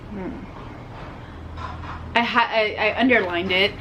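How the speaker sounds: ordinary delivery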